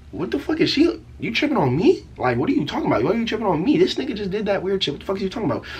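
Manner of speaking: put-on high-pitched, incredulous teen protest; indignant disbelief. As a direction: act out an outraged inner rant, voice jumping up. Acted outburst in his head.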